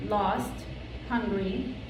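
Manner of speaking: ordinary delivery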